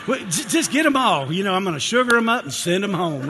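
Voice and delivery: giddy grandpa handing out everything; excited indulgent grandpa. Retold or imitated. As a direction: spoil them rotten with a big happy voice.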